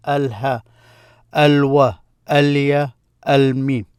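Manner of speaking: each Al- said with a clear L before the letter, as in Al-Ba; L pronounced. Shown as a demonstration. Teacher reciting moon letters, L sounded in each, as in Al-Ba. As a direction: recite Al-Ba, Al-Ja evenly, sounding every L. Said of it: "You see this L there".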